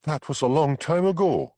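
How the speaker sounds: sharp and dismissive; defiant. A narrator's reading. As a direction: brush it off boldly. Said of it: "she said defiantly".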